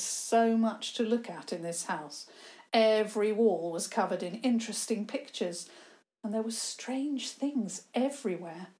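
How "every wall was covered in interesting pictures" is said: plain reading voice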